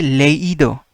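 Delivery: leído with the í sounded fully as its own vowel; leído, stress on a clearly separate í; emphasized I. Shown as a demonstration. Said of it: "I have to emphasize an I as well".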